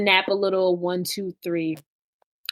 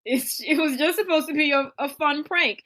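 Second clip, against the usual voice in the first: Courtney defending; 6 semitones higher than usual. Retold or imitated. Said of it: "Courtney's like".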